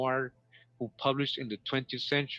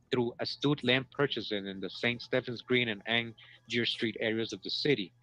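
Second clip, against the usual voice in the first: ordinary talk, nothing marked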